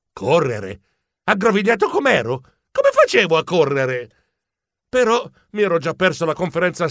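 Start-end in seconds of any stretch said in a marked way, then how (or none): none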